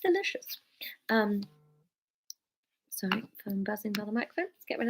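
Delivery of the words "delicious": breathy whisper aside. Real, not acted. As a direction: whisper it, savouring.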